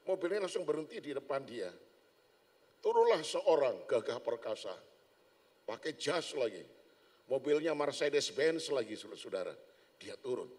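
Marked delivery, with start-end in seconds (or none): none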